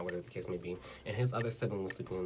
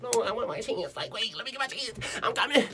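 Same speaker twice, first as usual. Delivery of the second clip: high, squeaky hurried protest; eager pleading; 12 semitones higher, 3 dB louder than usual. Acted. Begging for her chance back, squealed eagerness. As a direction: jump way up in pitch, mock-desperate. Acts out dropping the act, eager.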